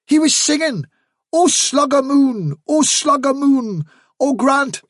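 high, excited witness quoting a song; insistent protest. A narrator's reading. Hafsa protests, quoting hedgehog's song.

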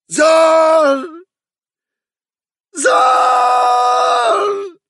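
very high, loud, drawn-out wail; piercing, desolate shriek held long; dreadful squealing cry. A narrator's reading. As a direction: squeal it like an unearthly rabbit. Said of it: "cried the dreadful, squealing voice".

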